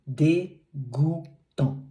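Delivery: Dégoûtant drawn out slowly with a nasal final an; slow careful Dégoûtant; slow French demo. Shown as a demonstration. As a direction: model Dégoûtant slowly for a learner.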